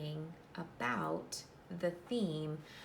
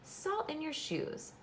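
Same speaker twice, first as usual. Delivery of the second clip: higher, slow, knowing "Salt in your shoes"; mama's answer; 10 semitones higher, slower than usual. Acted. Mama telling Michael her secret, slow and knowing. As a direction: give the secret gently, up high. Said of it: "Michael looked at his mama".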